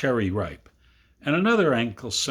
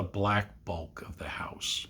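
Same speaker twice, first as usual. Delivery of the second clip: whispered; 4 semitones lower, 9 dB quieter than usual. A narrator's reading. Whispered dark house.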